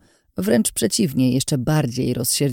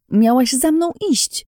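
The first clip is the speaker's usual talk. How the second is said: high-pitched scolding cry; exasperated raised voice; angry shout; 10 semitones higher, 3 dB louder than usual. A narrator's reading. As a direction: berate someone for not following. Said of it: "krzyknęła na mnie Ines".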